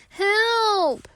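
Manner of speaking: high, loud panicked yelp; cry for help. Acted. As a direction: yelp for help, tangled and scared. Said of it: "he cries".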